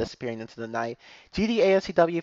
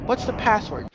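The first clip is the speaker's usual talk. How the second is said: mock shout; 7 semitones higher than usual. A narrator's reading. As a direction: call out a challenge without real volume. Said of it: "you shout out to the unknown voice".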